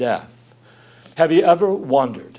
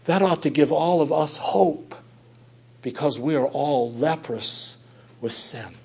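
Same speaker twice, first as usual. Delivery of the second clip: ordinary delivery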